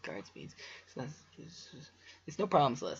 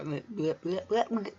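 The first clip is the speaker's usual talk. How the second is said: rising louder bleh bleh; frustrated blather; 6 semitones higher, 5 dB louder than usual. Real, not acted. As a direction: sputter nonsense in frustration. Frustrated stumble noise.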